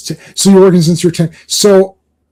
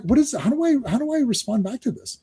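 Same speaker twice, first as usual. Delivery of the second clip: high, softer mock-baffled questions; imitated confusion; 7 semitones higher, 7 dB quieter than usual. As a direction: voice people lost and puzzled, pitched up. Voicing people confused by replying.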